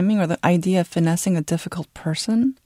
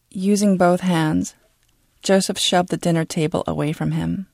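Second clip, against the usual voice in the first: plain reading voice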